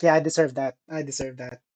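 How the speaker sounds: resigned. Retold or imitated. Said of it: "I was just like".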